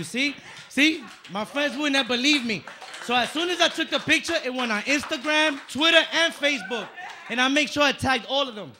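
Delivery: high, loud and thrilled; can't contain the excitement; excited bragging. Real, not acted. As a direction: show off the proof, buzzing. Bragging loudly about Billy Crystal.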